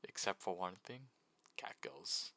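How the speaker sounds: ordinary talk, nothing marked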